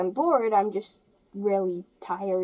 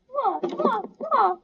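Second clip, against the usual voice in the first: kissing noises; 12 semitones higher, slower than usual. Pretend. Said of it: "like, annoying type voice".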